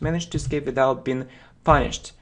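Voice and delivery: quick full-speed read; fast run-through. Shown as a demonstration. Teacher reading the definition back at full speed. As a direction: rattle it off at natural speed. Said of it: "fast run-through after chunks".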